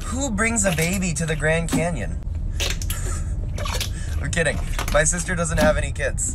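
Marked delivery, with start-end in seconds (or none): 2.3-4.2 soft laugh. Real, not acted. After dark joke.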